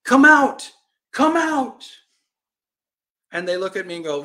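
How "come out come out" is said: high, loud, urgent call; crying out. Acted. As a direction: call across a distance to warn them. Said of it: "I cry out to them".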